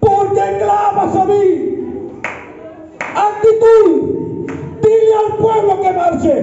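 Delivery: high, slow, shouted exhortation; rally the crowd, drive every word; full-force preaching. Acted. Pastor urging the congregation to march, shouting high.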